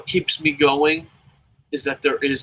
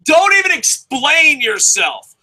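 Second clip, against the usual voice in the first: higher, louder, slow and emphatic; slow emphatic rant; 11 semitones higher, 5 dB louder, slower than usual. Real, not acted. Ranting vlogger telling viewers to walk away, slow and emphatic. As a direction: spell it out slowly and hard.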